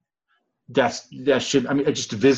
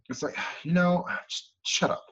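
sighing under breath; 4 semitones higher, 7 dB quieter than usual. Retold or imitated. Exasperated aside.

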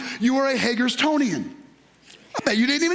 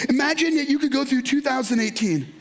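ordinary talk

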